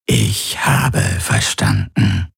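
slow, strange rasping whisper; a slow alien whisper; whispery creature voice. A narrator's reading. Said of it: "seine Flüsterstimme".

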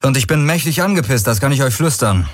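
a fuming rebel telling them off; angry cocky hero. Acted. Hero's threatening broadcast.

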